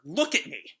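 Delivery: high, loud look at me; demanding shout. Acted. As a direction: force him to face you. She grabs him by the collar.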